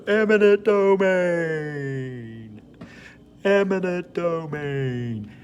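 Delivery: high, very slow, drawn-out sing-song; Seinfeld "the voice". Shown as a demonstration. Teacher saying the vocabulary word in the goofy voice. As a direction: stretch "Eminent domain" out in a goofy high voice. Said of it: "that voice that Jerry Seinfeld used to do".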